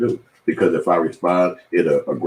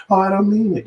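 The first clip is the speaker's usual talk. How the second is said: mock meek backpedaling.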